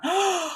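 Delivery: quick high gasp of delight; a breathy gasp of joy. Real, not acted. Reacting to a viewer's story.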